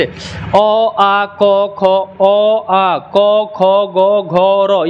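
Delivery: drawn-out अ, आ, क, ख in an even chant; held throat syllables अ, आ, क, ख, ग, घ; throat-sound drill. Shown as a demonstration. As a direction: sound out each of अ, आ, क, ख for learners to copy. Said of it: "फिर से बोल के ट्राई करो".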